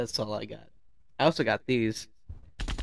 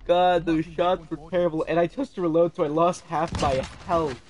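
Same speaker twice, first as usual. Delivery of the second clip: frustrated loud; 4 semitones higher, 11 dB louder than usual. Real, not acted. Frustrated at own play.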